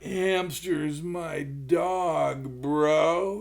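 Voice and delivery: very slow, dazed drawl; like he's on opiates, lightly voiced; stoned rock star.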